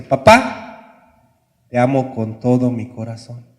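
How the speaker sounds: gather courage and blurt it out; brave declaration. Retold or imitated. Son finally tells his cold father he loves him, loud and brave. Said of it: "agarra valor y le dice".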